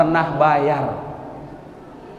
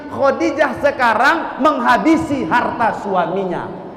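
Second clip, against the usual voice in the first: raised, slow emphatic sermon voice; fervent preaching; 6 semitones higher than usual. Acted. Preacher extolling Khadijah to the congregation, fervent sermon. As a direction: lift the story up with fervour.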